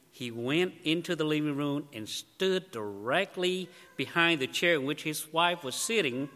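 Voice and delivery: ordinary talk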